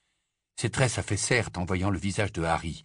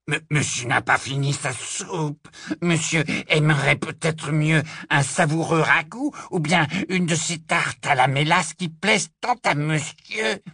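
higher, strained servile elf voice; obsequious creature voice, louder and higher; fawning house-elf; 7 semitones higher, 5 dB louder than usual. Acted. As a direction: grovel like an old house-elf. House-elf Kreacher fawning at dinner.

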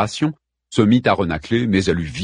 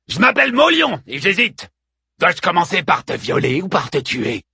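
menacing taunt; 9 semitones higher than usual. A narrator's reading. Looter threatens the Amazon queen, mocking menace.

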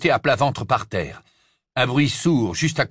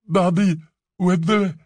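thick-mouthed name; 8 semitones higher, 3 dB louder, slower than usual. Acted. Bloodied Ron gives name.

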